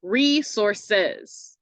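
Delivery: "resources" slowly in parts, REE-sor-SEZ; long-short-long syllables. Shown as a demonstration. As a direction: draw out the first and last syllables of "resources". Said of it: "long syllable, a short syllable".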